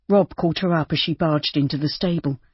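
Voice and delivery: ordinary delivery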